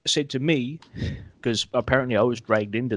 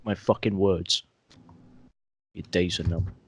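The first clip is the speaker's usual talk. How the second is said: mock menacing threat; 5 semitones lower than usual. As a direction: play the villain threatening someone.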